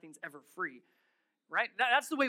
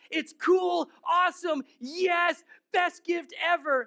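put-on loud high gushing kid voice; bubbling over like a kid on Christmas; excited kid; 8 semitones higher, 6 dB louder, slower than usual. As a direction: play a thrilled child with a new gift. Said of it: "That's how a kid reacts".

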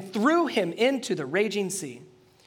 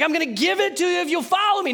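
higher, loud, fast, urgent call; God calling out; 13 semitones higher, 7 dB louder, faster than usual. Acted. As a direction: shout the promise, pleading. God voiced answering.

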